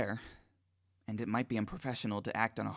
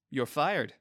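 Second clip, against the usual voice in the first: teasing; 3 semitones higher, 5 dB louder than usual. A narrator's reading. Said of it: "Doctor Mintz teases".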